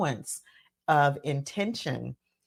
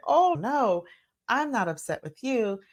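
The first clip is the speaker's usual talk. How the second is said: reassuring clarification; 7 semitones higher than usual. Retold or imitated. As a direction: set the child's mind at ease. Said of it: "backtrack and clarify and say".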